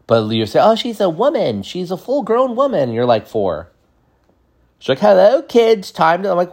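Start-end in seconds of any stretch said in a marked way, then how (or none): 0.6-2.9 high, lightly acted exclamation; a hint of mock awe; mock exclaiming. Acting out a child's view of teacher. 5.0-6.3 put-on high, loud greeting to little kids; sing-out teacher voice, pitched up; kindergarten teacher. Acting out the kindergarten teacher greeting class.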